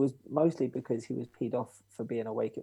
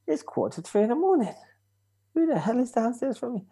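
pitched way up in disbelief; incredulous, much higher; 11 semitones higher, 3 dB louder than usual. Retold or imitated. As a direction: protest to dad, high and baffled. Woken at 3am, incredulous.

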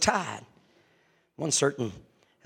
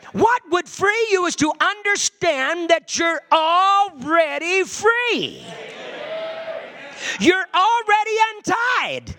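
higher, louder, shouted gospel preaching; fiery preaching; 10 semitones higher, 5 dB louder than usual. Acted. Preacher telling fallen believers they are free, shouting. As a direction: preach freedom to the congregation at full force.

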